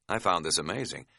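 usual speaking voice

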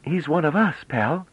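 put-on high girlish voice with a friendly smile; comradely smile. A narrator's reading. Bliss to Pelorat, high and chummy with a smile. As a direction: say it like a pal sharing a joke. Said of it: "with a comradely smile".

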